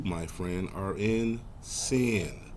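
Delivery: normal talking voice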